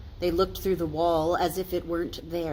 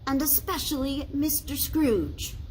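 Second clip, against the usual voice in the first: bright toast, pitched up; Bob's toast; 8 semitones higher than usual. A narrator's reading. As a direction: raise a glass and cheer it. Bob continues toast.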